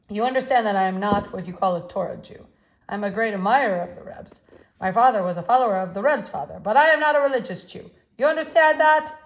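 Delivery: a hint of Russian accent, pushed hard; strong, forceful voice. A narrator's reading. Artist warning the boy off art, loud and forceful. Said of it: "He had a strong voice".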